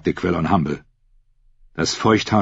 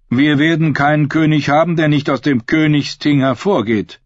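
higher, insistent priest voice; strenger Priester; 8 semitones higher, 4 dB louder than usual. A narrator's reading. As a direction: pronounce it like a priest's decree. (strenger Priester, mit erhobener Stimme)

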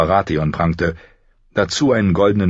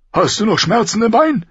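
high, forceful question; raised royal voice; blustering king; 4 dB louder than usual. A narrator's reading. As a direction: ask it brusquely, voice pitched way up. Blustering king questions wounded friend.

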